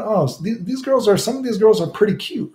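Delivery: high-pitched dawning realization; retold pleasant surprise. Traveler changing his mind in Japan, pleasantly surprised. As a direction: act out being won over.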